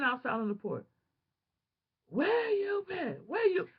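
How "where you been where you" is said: high, slow, drawn-out demand from a nosy worker; scolding shelter worker. Retold or imitated. Shelter worker interrogating her on the porch, high and slow. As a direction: stretch it out like a suspicious supervisor. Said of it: "The workers".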